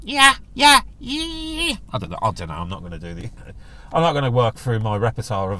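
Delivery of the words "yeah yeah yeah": high monkey yelps with a London twang; mock London-accented monkey calls, high-pitched; London howler monkey. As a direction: howl "yeah" like a Londoner monkey. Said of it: "if you get down south in London".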